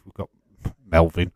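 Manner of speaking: ordinary talk, nothing marked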